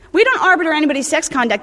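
ordinary delivery

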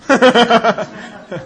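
mock his younger self with a laugh. Real, not acted. Laughing at the claim he was a man at twenty.

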